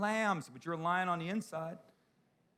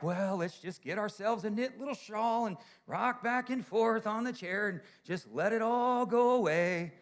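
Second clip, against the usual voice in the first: higher, slowing put-on voice of resigned old folks; mocking retirees; 6 semitones higher than usual. Pastor mocking churchgoers ready to retire, sing-song old-folks voice. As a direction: lampoon people who have given up. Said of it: "and we're all like".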